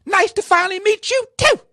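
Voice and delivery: hammed-up icy politician; mocking Maxine Waters. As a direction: mimic her frosty echo in a shrill put-on voice. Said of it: "And she said".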